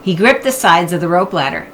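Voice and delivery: usual speaking voice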